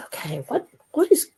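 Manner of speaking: a startled who's there; bewildered. Retold or imitated. Hearing the voice, asking who it is, bewildered. Baffled by a voice in her head.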